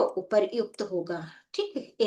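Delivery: ordinary talk, nothing marked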